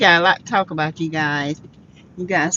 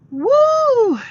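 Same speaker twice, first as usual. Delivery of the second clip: much higher laughing woo; excited whoop. Real, not acted. Host hyping viewers for the gossip, high laughing whoop.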